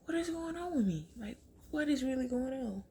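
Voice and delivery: nervous whisper; anxious self-talk. Retold or imitated. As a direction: panicky murmuring to yourself. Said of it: "I'm like".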